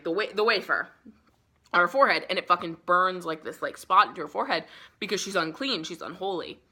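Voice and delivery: ordinary talk, nothing marked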